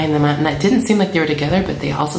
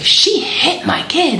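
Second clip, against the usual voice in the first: high, drawn-out indignant accusation; retold outraged protest; 12 semitones higher, 3 dB louder, slower than usual. Mother tells the man behind why she is charging, retold outrage. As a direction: re-enact it high and incensed.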